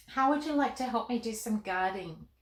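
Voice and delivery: plain reading voice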